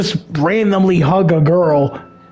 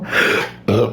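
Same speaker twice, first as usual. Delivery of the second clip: sharp gasp; 6 semitones lower than usual. Real, not acted. Chokes mid-reading.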